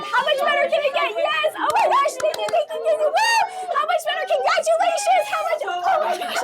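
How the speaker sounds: ecstatic celebration. Shown as a demonstration. Coach leading the room in loud joyful celebration. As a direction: scream your thanks like you just won. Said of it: "let's do this for, for some fun".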